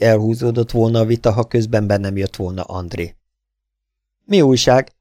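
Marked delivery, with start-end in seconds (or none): 4.2-4.9 louder, higher quick query; raised-voice, curious question; eager question. A narrator's reading. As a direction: ask eagerly for the news. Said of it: "kérdeztük szinte egyszerre".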